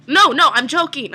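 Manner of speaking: scrambling to walk back a joke to a classmate; panicked correction. Retold or imitated. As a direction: blurt it out to undo the joke. Frantic backpedal to shopkeeper.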